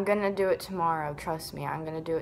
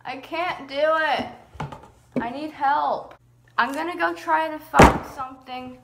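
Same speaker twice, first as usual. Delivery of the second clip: higher, louder, exasperated; frustrated whine; 7 semitones higher, 8 dB louder than usual. Real, not acted. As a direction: whine that you need help. Giving up on cutting, upset.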